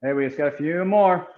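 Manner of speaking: normal talking voice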